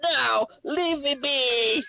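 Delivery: high, slow pleading cry; desperate plea. Acted. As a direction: beg him to leave you alone. Victim pleading with blackmailer.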